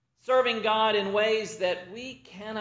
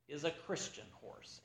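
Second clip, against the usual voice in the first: confiding whisper; 4 semitones lower, 20 dB quieter than usual. Retold or imitated. Pastor confides about the horse.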